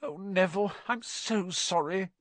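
tender, unhappy regret; miserable. A narrator's reading. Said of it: "said Hermione miserably".

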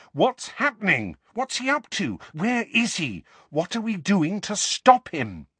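high, urgent volley of questions; heated, insistent interrogation; furious questioning. A narrator's reading. Harry furiously demands news.

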